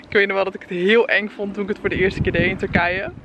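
usual speaking voice

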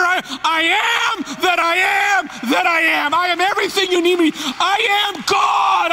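loud, high, proclaiming God's voice; voicing God, preaching. Pastor voicing God's declarations to the congregation. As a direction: proclaim each I am with full force.

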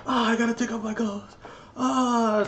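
high, frantic imagined panic; mock panic, pitched high; panicked. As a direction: voice a man panicking over blood on his clothes. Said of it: "So he probably was like".

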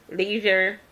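usual speaking voice

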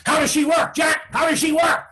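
loud, high, excited questioning; eager brothers shouting. A narrator's reading. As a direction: call out impatiently, high and loud. Eager brothers calling out to Jack.

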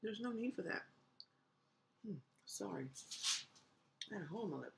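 chewing while talking; mouth full of food. Real, not acted. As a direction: napkin held to mouth. Seen 0: Eating before; mouth working, napkin pressed to lips mid-phrase.

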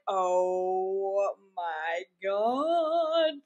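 a viewer's long, dragged gasp of shock; mock stunned viewer, slow; drawn-out oh my god. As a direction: stretch "Oh my God" out very slowly in disbelief. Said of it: "I was like".

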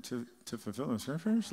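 low, soft, hesitant mock answer; timid questioning mumble, imitated; unsure congregation. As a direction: imitate a crowd doubting its own answer.

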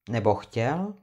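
ordinary delivery

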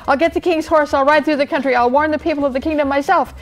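high, hurried declaration; urgent resolve. A narrator's reading. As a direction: rush out the plan excitedly. Bartholomew racing off with new resolve.

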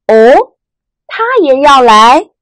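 哦 and 来 rising, slow surprised question; uptalk. Shown as a demonstration. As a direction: lift 他也要来 upward in doubt. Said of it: "声音是往上扬的". (疑问语气，声音往上扬)

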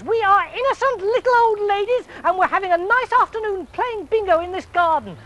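indignant granny shooing him off; little old lady. A narrator's reading. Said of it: "said the little old ladies".

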